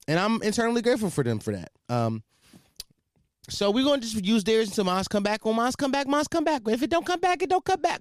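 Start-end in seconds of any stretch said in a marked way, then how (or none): 5.4-8.0 rising high squeaky repetition; mock fretting. Host fretting over equipment coming back, climbing high-pitched mock worry. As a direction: climb higher with each repeat. Rambling repeats about equipment.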